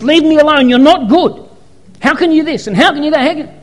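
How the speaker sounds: loud, high accusing rant; high, loud reenacted fury; angry rant. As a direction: throw the accusations at God. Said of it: "and I would get angry".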